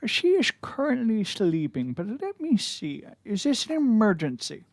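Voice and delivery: the gnome butler, politely guarded. Acted. Butler answers the visitors in character.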